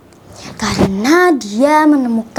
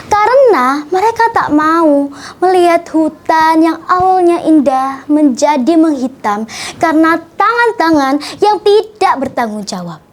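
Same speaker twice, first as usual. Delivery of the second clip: ordinary delivery, nothing marked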